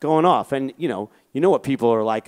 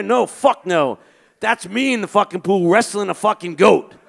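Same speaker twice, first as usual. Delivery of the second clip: mock outraged friend; 7 semitones higher than usual. Daryl snapping at his laughing friend on the phone, high indignant yell. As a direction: act out a furious friend who's been wronged.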